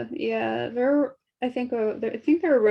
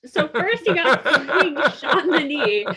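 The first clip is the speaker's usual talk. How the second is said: ordinary talk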